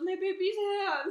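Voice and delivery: high, slow cooing with a laugh; doting mom. Retold or imitated. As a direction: coo like a smothering mother. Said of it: "holding her hand and goes".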